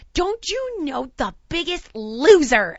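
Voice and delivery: hammed-up boastful mother; bragging mom. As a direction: announce it proudly, drawing out each word.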